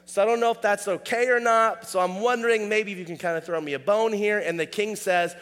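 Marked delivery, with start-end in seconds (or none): 0.0-4.4 pleading soldier. Retold or imitated. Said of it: "asking for mercy".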